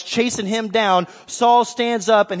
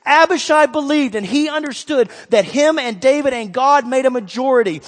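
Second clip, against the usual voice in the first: ordinary talk, nothing marked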